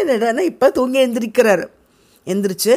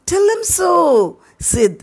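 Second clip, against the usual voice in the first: deathbed request; 9 semitones higher, much slower than usual. Acted. Tom's dying request.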